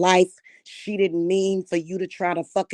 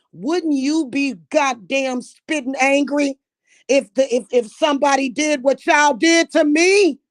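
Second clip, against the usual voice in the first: angry outburst; 6 semitones higher, 5 dB louder than usual. Real, not acted. Angry rant at family.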